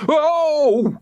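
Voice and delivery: high, loud screech. A narrator's reading. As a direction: yelp as you hit the ground. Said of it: "screeched George".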